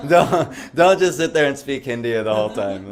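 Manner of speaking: joke-scold through laughter; mock warning delivered laughing. Real, not acted. Jokey advice, laughing loudly.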